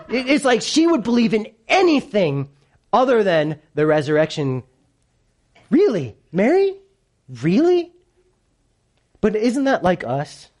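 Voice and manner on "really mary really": high, soaring disbelieving questions; mock incredulity. Preacher doubting Mary's excuses, mock incredulity. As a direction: question her in disbelief, pitched way up.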